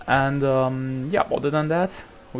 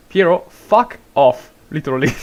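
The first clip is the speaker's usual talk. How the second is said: angry shout; 2 semitones higher, 10 dB louder than usual. Real, not acted. Yells at a chatter.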